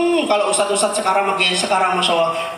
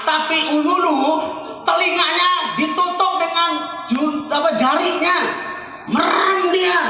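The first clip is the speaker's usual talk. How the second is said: high, loud, slow exasperated shouting; shouted angry plea; angry prayer; 14 semitones higher, 4 dB louder, slower than usual. Retold or imitated. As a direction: rail at God, fed up with the people. Nabi Nuh prays in anger.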